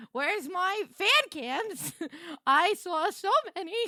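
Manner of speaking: high, loud, drawn-out whine; excited whine. Real, not acted. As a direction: beg loudly for the fan cams. Excited, whining for fan cams.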